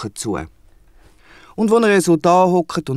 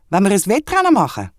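higher, louder, eager wombat asking; 7 semitones higher, 4 dB louder than usual. Acted. The wombat proposes a race to Henry, eager and higher. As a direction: pitch the challenge up, keen to race. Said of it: "fragt der Wombat".

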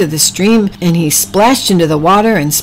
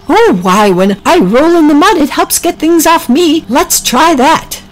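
Oliver's voice; 9 semitones higher, 3 dB louder than usual. Acted.

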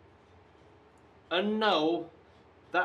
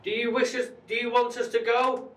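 high, raised question; calling to spirits; 8 semitones higher, 4 dB louder than usual. Real, not acted. Investigator asks spirit whether they should leave, calling out high. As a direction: call out to someone unseen.

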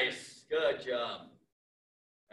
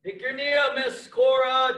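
ordinary talk

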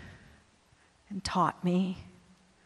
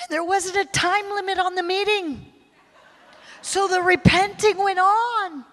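ordinary talk, nothing marked

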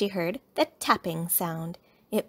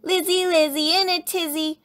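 high sing-song playground chant; loud teasing retort; shouted taunt; 9 semitones higher, 6 dB louder than usual. A narrator's reading. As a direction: jeer back in a rhyme, pitched up. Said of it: "Sister shouted back".

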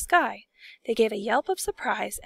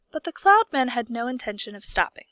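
ordinary delivery

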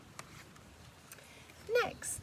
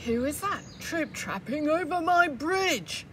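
higher, louder, slow troll roar; 8 semitones higher, 9 dB louder than usual. Acted. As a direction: bellow over the bridge. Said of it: "roared the troll".

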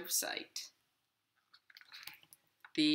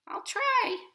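much higher squeaky little worm voice; tiny inchworm; 6 dB louder than usual. Acted. Inchworm begging the hungry robin not to eat him, high and squeaky. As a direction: plead as a tiny bug. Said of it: "he said".

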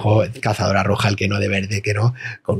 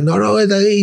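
high polite protest; 8 semitones higher than usual. Retold or imitated. Teen refusing bus seat.

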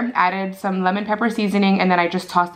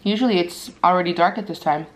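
bone between teeth; nibbling chicken wing bone; talking while chewing. Real, not acted. Seen 2: holds wing bone at mouth, bites it at 1.3-1.7s.

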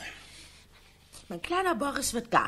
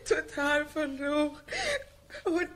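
tearful lament, rising to a sob; crying over the lost one; 7 semitones higher, 3 dB louder than usual. Acted. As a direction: weep the words out. Wife laments about lost dog.

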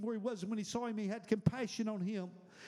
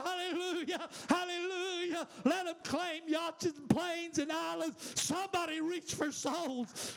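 preaching at full force; 5 semitones higher than usual. Acted. Preacher calling the congregation to weep for souls, high and ringing. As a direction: lift the room with a ringing, driving preacher's cry.

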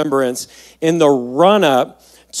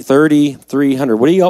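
usual speaking voice

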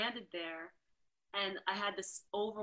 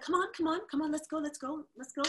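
high, brisk hurrying driver; pushy Dutch driver; 8 semitones higher, 5 dB louder, faster than usual. Retold or imitated. Promoter hurrying her from the airport, lightly voiced pushy. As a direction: rush her along impatiently. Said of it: "heavy, like, Dutch accent".

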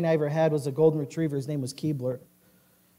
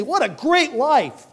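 mock delight; 9 semitones higher, 5 dB louder, slower than usual. Sinners delight in the world.

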